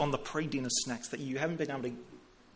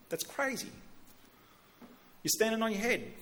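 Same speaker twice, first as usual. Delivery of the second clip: put-on kid voice; 6 semitones higher than usual. Son's view voiced.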